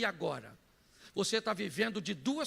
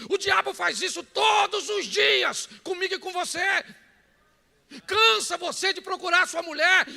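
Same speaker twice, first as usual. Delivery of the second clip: high, loud, impassioned sermon shout; full-force preaching; 9 semitones higher, 8 dB louder than usual. Real, not acted. Preacher telling the congregation they trade away what matters, full-force preaching. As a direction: drive every phrase home at full volume.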